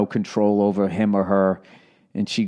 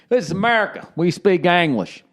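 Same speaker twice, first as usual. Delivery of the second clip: mock American patriot; 8 semitones higher than usual. Patriot scolding French speakers, mock proud proclamation. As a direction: proclaim it like a proud yokel, pitched up.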